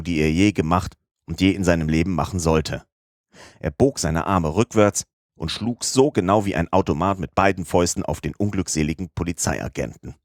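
ordinary delivery

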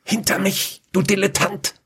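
hissed. Acted. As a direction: snap at him under your breath. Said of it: "zischte sie ihn an".

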